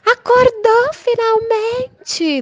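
high, slow mocking yell from upstairs; mock yell of neighbor. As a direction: shriek it down like a nosy neighbor. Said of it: "ela grita".

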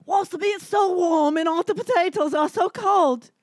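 loud, drawn-out fake Irish; Irish accent. Comic trying Irish for friends, loud bad Irish. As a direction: wail it in badly faked Irish. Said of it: "can you do an Irish accent".

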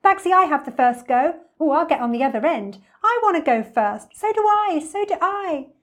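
put-on high, loud clamouring children; excited playground squeals of eagerness; excited shouting. A narrator's reading. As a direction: jostle loudly for first go. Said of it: "shouting with excitement".

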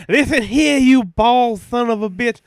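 loud, slow heel trash talk; wrestling promo. Acted.

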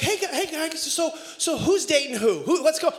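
lightly voiced curious wife; imitating wife. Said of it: "then she'd be like".

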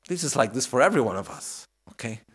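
ordinary talk

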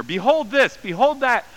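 mock the old-fashioned beholds grandly; mock King James style. Pastor mocking King James wording with hammed-up lofty tone. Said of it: "you still want to throw those, that word in there".

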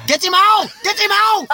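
very high, loud mock Vietnamese shout. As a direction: shriek it like an angry hostel owner. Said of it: "who's gonna do Vietnam".

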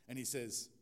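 usual speaking voice

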